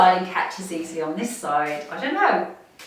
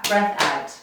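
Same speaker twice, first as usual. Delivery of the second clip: breath out dropped lower; falling breath-out cue; 6 semitones lower than usual. Shown as a demonstration. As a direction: let the voice sink on out. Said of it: "I've gotta breathe".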